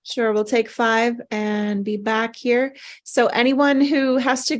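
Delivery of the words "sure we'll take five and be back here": ordinary talk, nothing marked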